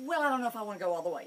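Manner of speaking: dog voice hedging fast and loud; hesitant dog. Retold or imitated. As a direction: rattle off the worry.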